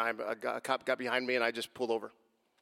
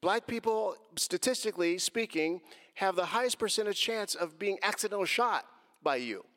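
high-pitched, insistent pleading of a case; retold heated argument; 7 semitones higher than usual. Driver arguing with officer who has gun drawn, agitated protest. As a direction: argue back urgently, replaying the moment. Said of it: "And I said, I said".

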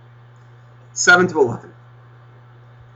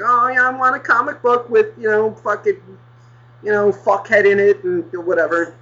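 high, whiny mock-kid voice; little kid; 7 semitones higher than usual. Kid asking for a comic book, high mocking child voice. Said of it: "Just like".

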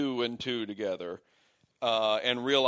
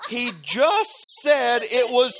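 much higher, louder mock shout with laughter; exasperated rant; 8 dB louder, slower than usual. Podcaster shouts at a movie character, laughing exasperation. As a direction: yell at the character in disbelief. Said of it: "And it's like".

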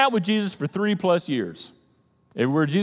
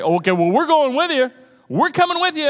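high, urgent plea to come along; pleading disciples; 8 semitones higher, 4 dB louder than usual. Retold or imitated. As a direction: beg to go with him, voice rising.

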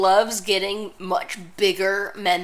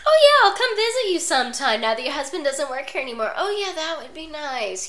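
high, put-on polite voices; hammed-up pleasantries, pitched well up; mock congenial chat; 7 semitones higher, 3 dB louder than usual. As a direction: imitate two women being sweetly civil. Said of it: "they're pretty congenial with each other".

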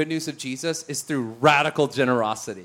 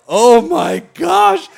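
loud, slow, high exclamation; astonished; 7 semitones higher, 12 dB louder, much slower than usual. Retold or imitated. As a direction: shout it out, stretched in amazement. Reacting as the man breaks down.

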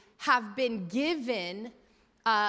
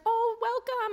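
much higher, sugary welcome as the nurse; sweet nurse; 13 semitones higher, slower than usual. Retold or imitated. Nurse greets the nervous mom-to-be, sweetly. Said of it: "she's so sweet".

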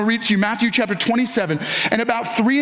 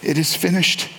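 lower, grave "It is finished", each word set down; solemn final words; 5 semitones lower than usual. Shown as a demonstration. Pastor voicing Jesus' last words to the congregation, low and grave. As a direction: let it fall low and heavy, like a last breath. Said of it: "can't adequately capture the emotion of that moment".

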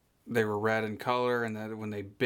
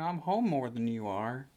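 normal talking voice

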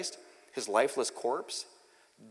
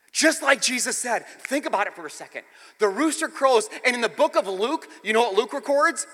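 raised, loud sermon delivery; impassioned preaching; 11 semitones higher, 10 dB louder than usual. Real, not acted. Preacher recounting the rooster's crow, impassioned preaching. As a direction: press each phrase on the congregation.

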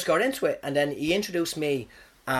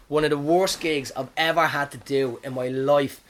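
ordinary delivery

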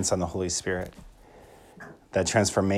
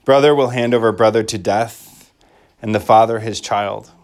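solemn liturgical reading; gospel proclamation. Acted. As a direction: proclaim it from the ambo.

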